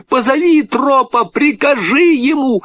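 high-pitched shouted instruction; urgent shout. A narrator's reading. Boy urging girl to command the dog, shouting. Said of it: "закричал Петя".

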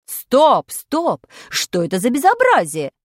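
loud stop, stop; teacher shouting at the choir; angry shout. Acted. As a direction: cut the singing off with a yell. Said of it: "закричала на нас Нина Васильевна".

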